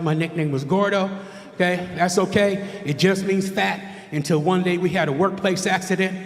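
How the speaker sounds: usual speaking voice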